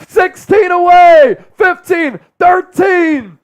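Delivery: loud, high shouted countdown; excited countdown. Real, not acted. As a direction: count it down, hyped. Counting down subs to goal.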